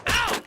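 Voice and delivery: sharp high yelp of pain. Acted. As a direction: yelp like you just got smacked. Being hit in aversion therapy.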